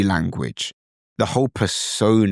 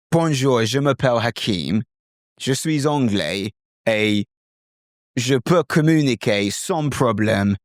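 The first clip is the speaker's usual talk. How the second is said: French in a strong English accent; British-accented French. Shown as a demonstration. Teacher showing learners how Brits sound, British-accented French. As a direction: speak French with heavy British intonation. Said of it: "pay attention to the tone of my voice now, the intonation, the accent".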